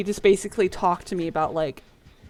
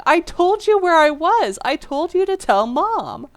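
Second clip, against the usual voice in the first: indignant protest; 8 semitones higher than usual. Retold or imitated. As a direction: insist you did nothing wrong. Child protesting to sister.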